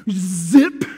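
loud sharp imitated zip; sudden loud zip; zip sound. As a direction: snap out the zipper noise. Zipper going up.

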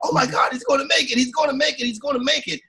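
high-pitched retold amazement, no full scream; amazed excitement. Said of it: "screaming in amazement".

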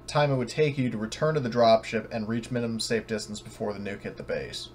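ordinary talk

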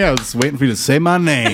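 normal talking voice

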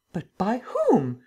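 slow, high demand; angry cry. A narrator's reading. The Emperor learns Lupin was drugged, angry cry. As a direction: indignantly demand the culprit, drawing it out. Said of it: "cried the Emperor, angrily".

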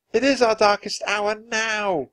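put-on high-pitched, urgent child's cry. A narrator's reading. Annie to Jack as the wave comes, crying out. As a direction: cry it out in alarm. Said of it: "cried Annie".

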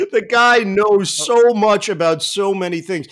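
ordinary talk

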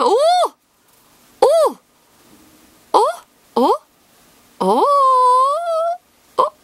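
high, loud surprised cries; excited shout. Real, not acted. Opening a pack and spotting a rare card, excited yelps. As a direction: yelp at the big pull.

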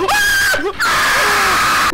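loud, high, long screams; terrified screams. Real, not acted. Player jump-scared in game, terrified screams. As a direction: scream full out, twice. Monster jumps out at him.

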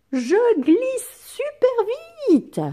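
high, slow, loud excited cry; excited exclamation. Acted. Teacher voicing the girl on the slide, excited cry. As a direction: squeal "Je glisse super vite!" like a thrilled little girl. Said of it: "elle pourrait dire".

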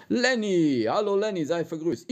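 high, loud called-out hello; sing-song greeting. Real, not acted. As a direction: call the names out cheerfully. Streamer calling out viewer greetings.

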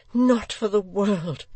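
high, loud, horrified refusal; shuddering. A narrator's reading. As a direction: recoil from the idea, slowly. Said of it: "answered with a shudder".

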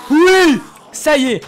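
loud, high victory yell; triumphant shout. Real, not acted. Victory shout when pearl drops.

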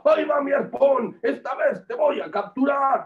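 high, loud hunter's battle call; triumphant attack cry. Acted. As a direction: cry it out as you hurl the harpoon. Hunter throws his harpoon.

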